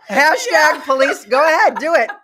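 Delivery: high, loud words through laughter; spluttering with laughter, urging her on; laughing. Real, not acted. Host eggs on her cohost's joke while laughing hard.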